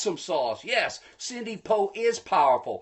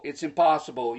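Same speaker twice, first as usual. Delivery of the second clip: usual speaking voice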